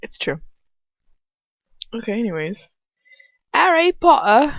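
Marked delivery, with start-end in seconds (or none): 3.5-4.5 high, loud Cockney; Cockney accent. Acted. Trying out character accents before reading, Cockney. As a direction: call it out in a mock Cockney accent. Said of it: "Little bit of the Cockney?".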